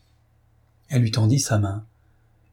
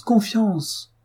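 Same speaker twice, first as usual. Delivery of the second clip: coaxing repeat; 10 semitones higher than usual. A narrator's reading.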